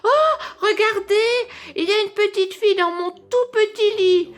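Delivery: shrill the discovery; piercing little bear. A narrator's reading. Baby bear to his parents, piercing. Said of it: "de sa petite voix perçante".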